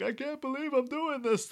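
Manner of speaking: very high mock-anguished voice; performed reluctance. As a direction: put on a high, overacted lament. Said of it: "to even perform to Hans".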